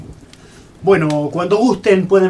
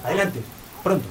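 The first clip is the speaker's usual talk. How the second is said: soft shh to silence them; quiet them down urgently; hushing; 9 dB quieter, faster than usual. Acted. Conspirator hushing the reporters at the secret meeting, soft.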